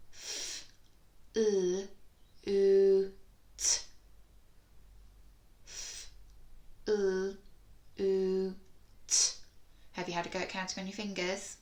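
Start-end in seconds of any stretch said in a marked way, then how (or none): 0.1-9.3 F, l, oo, t each sound apart with oo loud and held; segmented phonics. Shown as a demonstration. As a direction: break flute into single sounds. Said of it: "He said".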